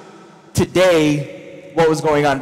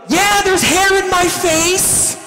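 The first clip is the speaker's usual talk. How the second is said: very high, stretched-out shout; acted exasperated yell; frantic yelling; 12 semitones higher than usual. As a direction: holler back over the storm. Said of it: "they're probably like".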